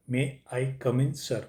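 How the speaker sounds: may I come in sir drawn out slowly, word by word; slow model phrase. Shown as a demonstration. Teacher slowly models may I come in sir for students.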